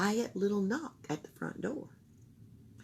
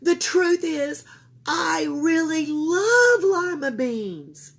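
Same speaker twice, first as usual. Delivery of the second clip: put-on child confessing; 10 semitones higher, 8 dB louder, slower than usual. A narrator's reading. Camilla confesses.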